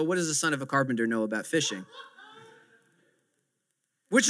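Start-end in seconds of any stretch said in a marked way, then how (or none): 0.0-1.9 low, fast mocking aside with a laugh; scoffing aside. Pastor mocking Peter's doubt about Jesus, low scoffing laugh. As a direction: sneer it under your breath, half laughing. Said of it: "he doesn't disrespect him and go".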